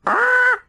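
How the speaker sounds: a high, loud gull shriek; sudden high bird squawk; seagull scream. A narrator's reading. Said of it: "screamed Stornoway".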